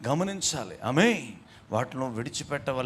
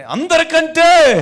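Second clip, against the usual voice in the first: అందరికంటే roared out, much higher and louder, slow; full shout; 14 semitones higher, 16 dB louder, much slower than usual. Shown as a demonstration. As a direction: shout అందరికంటే with everything you have. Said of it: "గట్టిగా చెప్పవే".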